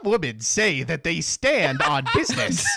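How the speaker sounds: lightly put-on evil Pikachu, laughing; a hint of cartoon villain Pikachu. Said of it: "Let me do the evil Pikachu voice".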